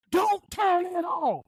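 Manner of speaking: slow, high, drawn-out mock yell; mock outburst. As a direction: imitate a patient going off, stretching every word. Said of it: "Please don't yell at me".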